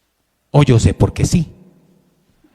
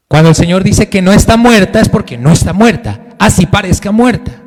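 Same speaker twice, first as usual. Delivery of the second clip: louder, higher proclamation; preaching emphasis; 6 semitones higher, 5 dB louder than usual. Real, not acted. Preacher urging the congregation, loud and higher. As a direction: proclaim it with full conviction.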